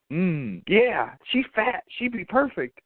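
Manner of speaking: drawn-out, high-pitched savouring; slow relish climbing high; mock relish. Ezra savours the neighbour's suggestion.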